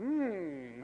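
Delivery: soft, suspicious hum; Nietzsche. Acted. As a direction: hum skeptically, as a lightly played philosopher. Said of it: "a philosophical squinting".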